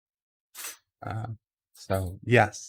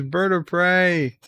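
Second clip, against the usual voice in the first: dramatic reading; 7 semitones higher than usual. Retold or imitated. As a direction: proclaim "Bird of prey" like a grand title. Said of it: "Wesley Crusher says".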